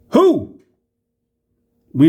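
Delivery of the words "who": high, loud exasperated "Who?"; exasperated shout. Real, not acted. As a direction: throw the question out, fed up. Exasperated question.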